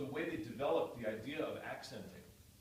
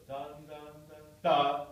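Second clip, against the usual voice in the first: sung "dun, dun, dun" then a much louder "da" punched out; too loud accent; 3 semitones higher, 10 dB louder, slower than usual. Shown as a demonstration. Teacher shows the wrong loud accent to the student. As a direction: hit the last "da" far too loud. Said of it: "That's too loud".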